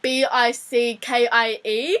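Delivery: B I C K I E slowly, letter by letter; slow spelling, each letter said separately; spelled out. Shown as a demonstration. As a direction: spell out B I C K I E one letter at a time. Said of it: "Bicky is spelled".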